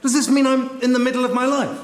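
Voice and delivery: high, urgent plea to wait; alarmed protest. Acted. Contestant halting the departing host, alarmed and high.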